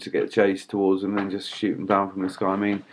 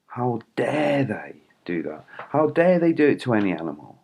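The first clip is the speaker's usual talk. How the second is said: indignant; 3 semitones higher than usual. Retold or imitated. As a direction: let each "how dare" hang in disbelief. Retelling indignant thought on animal cruelty.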